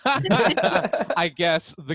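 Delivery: slow words through real laughter; laughing. Real, not acted. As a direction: laugh your way through it, slowly. Laughing at the accidental reveal.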